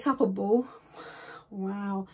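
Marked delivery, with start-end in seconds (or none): none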